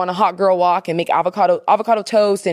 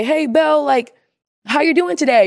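high-pitched imitation of a chirpy passerby; mock friendly greeting; 7 semitones higher than usual. Townspeople saying hey to Belle, high mock friendly greeting. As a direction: call out a put-on hello, pitched up.

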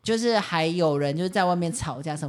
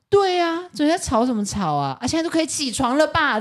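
loud pointed shout; 10 semitones higher than usual. Retold or imitated. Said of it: "你楼下女生就大喊".

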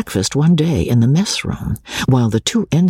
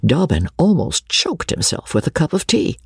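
ordinary delivery, nothing marked

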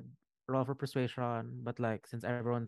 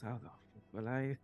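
softer, muttered to himself; under his breath; 5 dB quieter than usual. Acted. Dragon man looking at his dragon, muttering under his breath. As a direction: drop the volume for a private grumble. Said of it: "He, under his breath".

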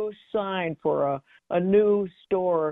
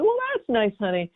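imitating mom; 3 semitones higher than usual. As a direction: lightly mimic a mother humoring her child. Voices mother's indifferent reply.

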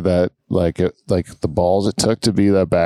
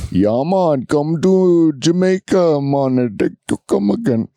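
put-on drawn-out, higher Jamaican accent sliding into Indian; bad Jamaican accent; 5 semitones higher, slower than usual. Host imitating niece's game, Jamaican accent drifting to Apu. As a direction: imitate a kid's Jamaican turning into cartoon Indian. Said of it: "Do Jamaican".

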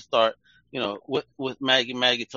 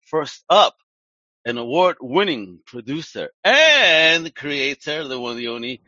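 drawn-out hype introduction, louder; slow, loud showman build-up to the name; announcer intro; 2 semitones higher, 6 dB louder, slower than usual. Acted. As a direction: announce her like a stage emcee. Host announcing guest like emcee.

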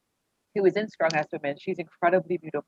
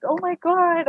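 high, loud burst of delight; high thrilled oh my God; excited gasp; 13 semitones higher, 5 dB louder, slower than usual. Retold or imitated. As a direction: squeal it out, overjoyed. Thrilled at photo of bags.

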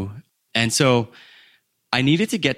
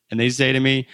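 normal talking voice

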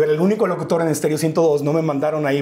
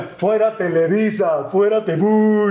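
drawn-out protest chant sliding into a boo; imitated jeering crowd; 3 semitones higher, much slower than usual. Audience jeering Televisa at the host, imitated crowd chant.

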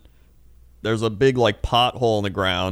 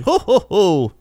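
high, loud theatrical ho ho ho; jolly showman laugh; 9 semitones higher, 5 dB louder than usual. Retold or imitated. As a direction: boom it like a jolly performer. Performer laughing at woman.

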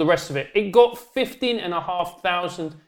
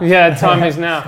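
slow loud echo; 7 dB louder, slower than usual. Real, not acted. Echoing the joke.

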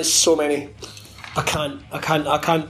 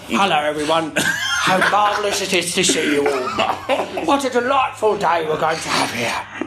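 bad impression of a genteel speaker; drawn-out, overly polite mock gentleman; Bram. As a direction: greet the room in a hammed-up gentlemanly voice. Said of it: "I know my impression's shit".

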